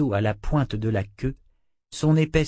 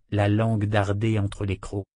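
plain reading voice

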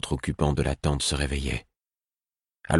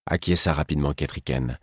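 ordinary delivery

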